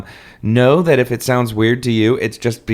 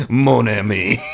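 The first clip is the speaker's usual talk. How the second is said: put-on French accent; slower than usual. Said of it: "Don't do a horribly offensive accent".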